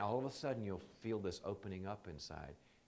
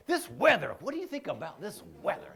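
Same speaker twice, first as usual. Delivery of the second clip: higher, loud sudden subject change; lightly voiced awkward friend changing topic; mock deflection; 7 semitones higher, 8 dB louder than usual. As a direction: blurt it out like a nervous dodger.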